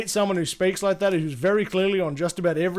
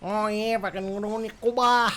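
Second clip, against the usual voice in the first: put-on droning caricature of an Australian accent; mock Australian noise; 3 semitones higher than usual. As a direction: slur it together as a mock Aussie. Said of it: "if I keep doing that noise".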